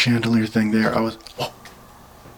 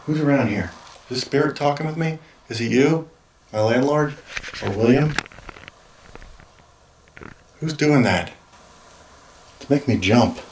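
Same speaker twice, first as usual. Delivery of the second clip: raised higher voice asking the room; calling out to spirit; 4 semitones higher than usual. Real, not acted. Alone in the house, asking the spirit who is there, calling out higher. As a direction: call out into the empty house, uneasy.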